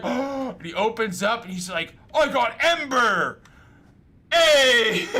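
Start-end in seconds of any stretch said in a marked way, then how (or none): 2.1-5.1 higher, louder and slow, cheering over a laugh; cocky ad guy. Retold or imitated. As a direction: ham up the smug winner from the ad. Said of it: "the guy's like".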